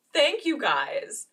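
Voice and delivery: slow, high, loud re-enacted thanks; awkward thanks. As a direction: stretch out the mock thank-you. Acts out thanking for duplicate gifts.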